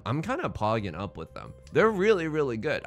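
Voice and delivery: ordinary delivery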